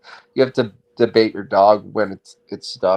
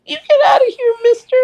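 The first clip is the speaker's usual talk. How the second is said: very high, louder squeaky little dog; tiny dog voice; 5 dB louder than usual. Pretend. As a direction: yap it out as a tiny guard dog. Little Yorkie guarding house.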